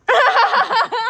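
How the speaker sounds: high, explosive laughter; big high-pitched cackling laugh; loud laugh. Real, not acted. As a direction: burst out laughing loudly. Laughing at husband's reply.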